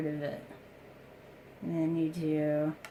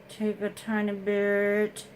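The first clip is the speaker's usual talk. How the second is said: ordinary talk, nothing marked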